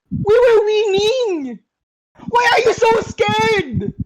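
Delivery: drawn-out, high, bitter complaint; slow exasperated plea. Real, not acted. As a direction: stretch every word out in disbelief. Team fled, slow exasperated plea.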